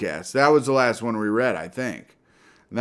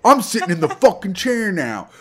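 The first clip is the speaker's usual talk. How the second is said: mock angry rant; 4 semitones higher than usual. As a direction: play the furious co-host, hammed-up. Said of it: "And you were like".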